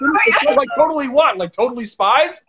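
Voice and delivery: mock Valley Girl uptalk; Valley Girl accent. Shown as a demonstration. Host jokes with guest, Valley Girl accent. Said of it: "do the Valley Girl accent".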